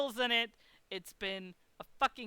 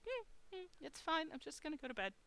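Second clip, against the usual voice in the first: small, high, soft voice; masking distress; 7 semitones higher, 9 dB quieter than usual. Retold or imitated. Guest masking upset from hotel staff, small soft reassurance. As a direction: brush it off quietly, pretending all is fine. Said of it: "I put on my mask".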